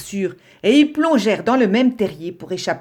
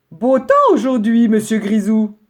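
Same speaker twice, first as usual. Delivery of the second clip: polite rabbit reply; 4 semitones higher, 6 dB louder than usual. Acted. Brown rabbit replies politely.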